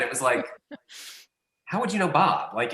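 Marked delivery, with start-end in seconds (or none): 1.6-2.5 imitating her knowing question, pitched up; lightly voiced teasing Becca; mock suspicious tone, higher. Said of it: "the way she said it".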